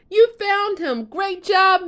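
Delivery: high, loud delighted cheer; excited praise. Acted. Rapunzel cheering Max for finding Pascal, excited and loud. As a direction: burst out with joy at the find. Pascal found asleep in stables.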